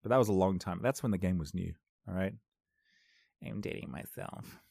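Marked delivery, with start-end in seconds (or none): 3.4-4.6 whispered aside; muttered aside. Real, not acted. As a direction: mutter it under your breath. Aside muttered under breath.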